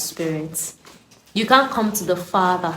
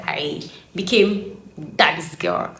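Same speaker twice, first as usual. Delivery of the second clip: usual speaking voice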